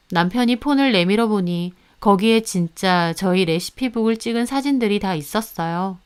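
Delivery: ordinary delivery, nothing marked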